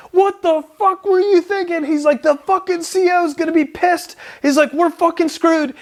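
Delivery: high-pitched mock shriek of a furious sergeant; imitating a yelling staff sergeant. Staff sergeant going off at a marine over a fake certificate, high mock shriek. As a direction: squeal it like a panicked boss losing it. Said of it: "he just starts fucking going off".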